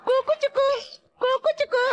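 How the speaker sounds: high cooing peekaboo voice; baby talk. Acted. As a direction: coo it up high like playing with a baby. Cooing at bride like a child.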